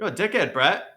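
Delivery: bad imitation of a New Zealand accent.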